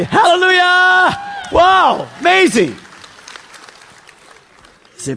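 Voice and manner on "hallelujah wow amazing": high, loud, drawn-out praise cry; exuberant shout. Pretend. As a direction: whoop it out to the whole crowd. Whipping up the congregation's chant.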